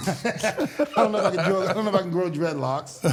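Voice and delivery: laughing through the words. Real, not acted. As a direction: chuckle as you say it. Laughing about thinning hair.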